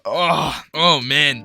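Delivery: ordinary talk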